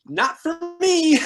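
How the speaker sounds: high, slow, loud "not for me"; drawn-out comic nope; flat refusal. Retold or imitated. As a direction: back off from the raging river. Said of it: "and I go".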